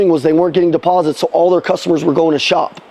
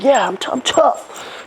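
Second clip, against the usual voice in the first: imitating small kid; 5 semitones higher, 4 dB quieter, slower than usual. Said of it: "My kid's like".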